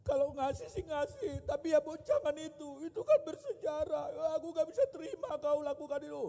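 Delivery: high-pitched mock wailing protest; crying, angry wife. Wife upset about the given wedding ring, mock tearful high wail. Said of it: "Dia nangis, dia marah, dia bilang".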